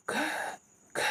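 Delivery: breath only, no voice; teacher's voiceless whisper demo. Shown as a demonstration. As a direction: whisper each sound separately. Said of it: "काना फूसी की आवाज़ में बोल रहे हैं".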